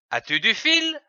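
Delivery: As-tu du fil? with the voice rising high at the end; rising question. Shown as a demonstration. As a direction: ask As-tu du fil? as a real question, climbing on fil. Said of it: "Notez la ponctuation ... Il sert à poser une question". (intonation montante, voix qui monte haut sur « fil »)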